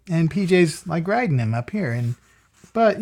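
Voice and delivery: ordinary delivery, nothing marked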